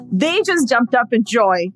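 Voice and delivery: normal talking voice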